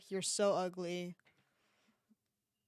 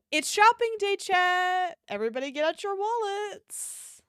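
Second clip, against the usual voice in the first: hyped announcement; 9 semitones higher, 5 dB louder than usual. Real, not acted.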